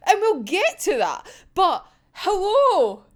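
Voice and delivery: usual speaking voice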